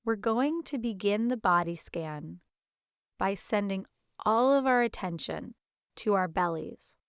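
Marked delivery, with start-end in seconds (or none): none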